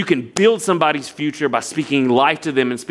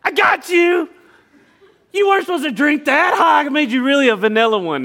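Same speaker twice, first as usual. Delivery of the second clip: much higher, laughing prank reveal; gleeful gotcha; 10 semitones higher than usual. Retold or imitated. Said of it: "all of a sudden she goes".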